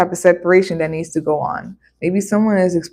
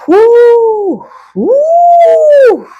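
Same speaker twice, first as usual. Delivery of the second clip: loud, high, long whooping call; high whoo; 9 dB louder than usual. Acted. Reacting to a dead-end card with a loud whoop. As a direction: whoop it out like a siren.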